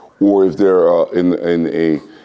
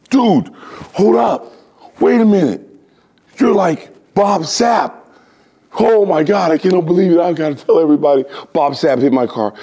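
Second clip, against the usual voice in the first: high, excited fan voice; imitating a starstruck guy whose car got hit; starstruck car owner; 12 semitones higher than usual. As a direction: gush like a stunned fan.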